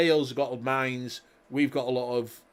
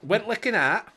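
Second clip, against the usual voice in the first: Yorkshire accent; 6 semitones higher than usual. Shown as a demonstration. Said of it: "that's Yorkshire".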